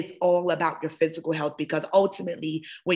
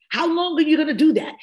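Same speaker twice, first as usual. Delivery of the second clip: higher, louder and fast, pushing hard; rapid, raised challenge; fast pressing question; 9 semitones higher, 5 dB louder, faster than usual. Acted. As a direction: fire the question at them quick and high. Repeated question, fast then slow.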